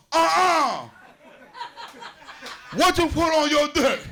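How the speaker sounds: high, loud, alarmed cry; shocked girlfriend shout. Retold or imitated. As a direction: shriek it like she's horrified.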